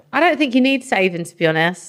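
usual speaking voice

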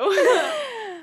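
loud high-pitched giggle; high laughter. Real, not acted. Laughing together.

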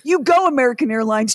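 fast, loud sarcastic "You go, American Airlines". Shown as a demonstration. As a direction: spit it out as a curse. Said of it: "to hell is at the end of the sentence".

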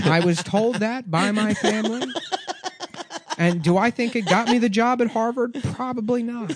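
high, earnest candidate impression; Elizabeth Warren. Senator explains her family lore, high mock-earnest impression. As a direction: pitch it up, defensive and amused.

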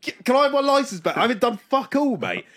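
high, pleading protest at the officer; outraged tourist begging for his license back; exasperated plea. Retold or imitated. Tourist protesting to the cop, high and exasperated.

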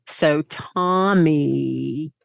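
slow, low Southern gossip; Southern girlfriend. Retold or imitated. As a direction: draw out the news in a Southern accent. Voicing Southern girlfriend delivering the news.

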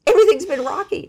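everything's rocky, high and loud; excited exclamation. Real, not acted. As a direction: burst out with it. Exclaiming about her rocky life.

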